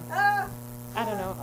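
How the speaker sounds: very slow, resigned I don't know; slow deflated. Acted. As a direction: drag it out, giving up. Drawn-out deflated repeat.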